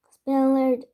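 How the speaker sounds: ordinary delivery, nothing marked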